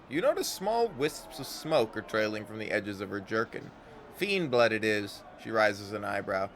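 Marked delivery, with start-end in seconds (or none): none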